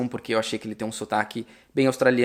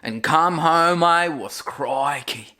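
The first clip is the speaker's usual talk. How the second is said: imitating Steve Owen; 4 semitones higher, much slower than usual. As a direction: echo the broad Aussie "crikey" slowly. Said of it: "quando ele fala home". (imitando o sotaque australiano, bem devagar)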